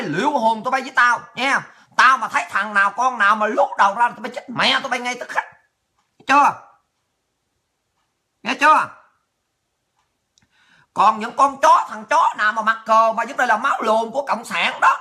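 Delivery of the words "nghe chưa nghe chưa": louder, barked "Nghe chưa?" with a falling end; shouted demand. Real, not acted. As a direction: yell "Nghe chưa?" at them. Repeated threat to viewers.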